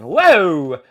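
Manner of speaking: startled whoa. Real, not acted. Startled by his own slip.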